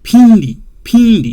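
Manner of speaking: Pindi loud and high, with an E-sounding i; correct Pindi. Shown as a demonstration. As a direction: say Pindi firmly, keeping the M silent. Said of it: "sound like E".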